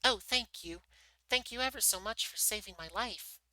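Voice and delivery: high-pitched squeaky mouse; squeaky little voice. A narrator's reading. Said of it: "in a squeaky little voice".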